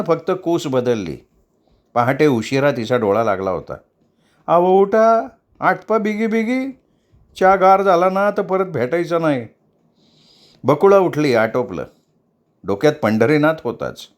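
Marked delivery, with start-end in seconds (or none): 4.5-9.5 maid hurrying her, high and pressing; urging hurry. Acted.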